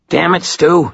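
high-pitched, irritable snarl; snarled irritation. A narrator's reading. Maroon scolds Stu without looking up, snarling. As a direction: snarl at a bothersome coworker. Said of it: "he snarled".

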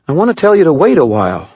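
high, fast, pained woman's voice; lightly voiced Murphy, rushing on; Murphy, emotional. A narrator's reading.

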